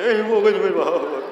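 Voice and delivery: hammed-up garbled sob on "Eh, mau ke sini, Mak"; unclear crying. Child crying unclearly to mother, mock garbled sobbing. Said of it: "Nangis, ndak jelas".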